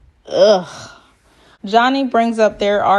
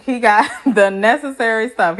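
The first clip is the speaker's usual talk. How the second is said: ordinary talk, nothing marked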